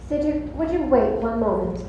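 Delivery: ordinary talk, nothing marked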